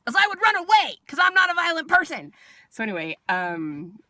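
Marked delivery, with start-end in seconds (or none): 0.0-2.3 high, loud mock-indignant protest; comic outburst. Patient joking about escaping the nurse, loud comic outburst. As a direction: blurt it out loudly in mock exasperation.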